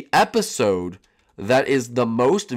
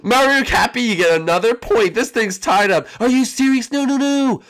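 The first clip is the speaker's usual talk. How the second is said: high, loud game show outburst; excited announcement; 11 semitones higher, 5 dB louder than usual. Acted. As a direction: shout it out in disbelief. Host announces point, rival protests.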